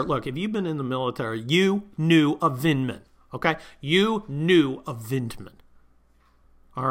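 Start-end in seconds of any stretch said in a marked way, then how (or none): none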